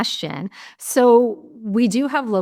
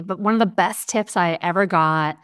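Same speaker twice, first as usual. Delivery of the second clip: normal talking voice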